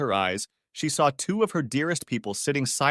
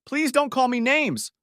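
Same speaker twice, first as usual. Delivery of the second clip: high, slow, pleading voice; begging; 4 dB louder than usual. Acted. Wife begs her daughter to stop, pleading. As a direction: beg him to make it stop. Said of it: "Linda begged".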